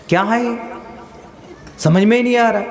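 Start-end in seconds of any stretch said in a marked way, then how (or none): none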